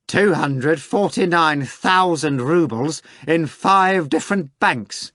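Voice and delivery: high, slow cracked voice from next room; a gleeful crackling tattletale; high cracked voice spilling his secret. Acted. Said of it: "came a cracked voice".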